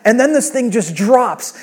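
ordinary delivery

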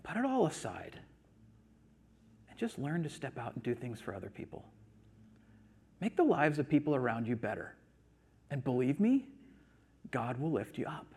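soft, measured, with long pauses; hushed, patient appeal in God's role; God speaking gently. Retold or imitated. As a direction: counsel quietly, letting each phrase settle. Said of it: "God just looks at us and he says".

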